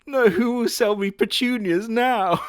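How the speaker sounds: loud, high, slow hammed-up cry; mock anguished wail. Mourning the lost florist with a loud mock wail. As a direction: wail to the heavens in fake grief. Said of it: "Oh, sad music".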